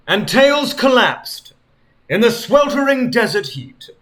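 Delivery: hammed-up dramatic narration; dramatic story reading. A narrator's reading. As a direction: read it like a grave epic. Dramatic fanfic reading opening.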